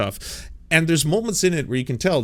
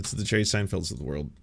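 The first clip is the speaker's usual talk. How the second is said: ordinary delivery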